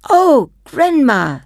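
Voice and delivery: high, slow awed gasp; a child in wonder; delighted child. Acted.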